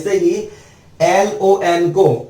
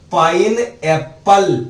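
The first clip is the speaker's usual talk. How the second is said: Pine Apple said slowly, word by word; slow demo word; much slower than usual. Shown as a demonstration. Teacher to class, slow pine Apple. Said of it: "पूरी spelling क्या बन गई".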